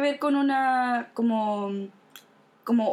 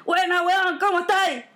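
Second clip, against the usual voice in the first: raised-pitch playful dog-to-dog holler; mock calling out; 5 semitones higher than usual. Imagining dogs greeting each other, mildly hollered hello. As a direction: call out a greeting as a chatty dog. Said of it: "se están gritando como".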